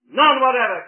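None whatever hurled out loud and high. Shown as a demonstration. Preacher answering his own question, a loud emphatic cry. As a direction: slam None whatever out at full force.

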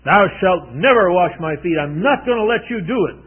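loud, high, insistent Peter refusing; emphatic refusal. Retold or imitated. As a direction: plant your feet and refuse him outright. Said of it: "He became very emphatic".

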